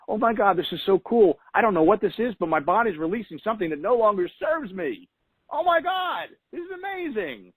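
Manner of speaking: high, gushing amazement climbing to a squeal; excited reframe. Acted. Coach acting out her reframe of crying on the treadmill, excited amazement. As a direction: celebrate it with rising, high-pitched wonder. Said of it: "here's the reframe".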